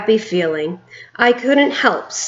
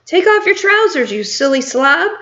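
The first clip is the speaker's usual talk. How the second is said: higher mocking call; shouted; 7 semitones higher than usual. A narrator's reading. Another cook shouting at the panicking man, mocking higher call. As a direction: jeer at him across the kitchen. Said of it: "someone else shouted".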